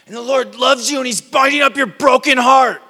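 very loud, high preacher yell; inappropriate yelling. Acted. Showing how not to give someone a tender word, mock yelling. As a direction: bellow tender words as if raging from a pulpit. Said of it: "one tone of voice and it's yelling".

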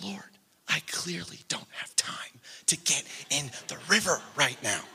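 low, half-whispered objection; hushed protest. Retold or imitated. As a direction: protest under your breath, as if retelling a private prayer. Retold reluctant answer to God.

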